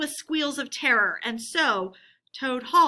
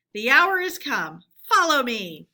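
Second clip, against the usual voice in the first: loud battle call; rally the comrades with a louder call; cry out; 8 dB louder than usual. A narrator's reading. Badger leading the charge into the hall, loud rallying cry. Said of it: "and cried".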